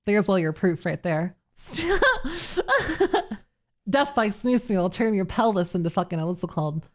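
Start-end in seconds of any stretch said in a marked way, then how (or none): 1.7-3.3 high, breaking cackle; laughing. Real, not acted. Laughs at chat.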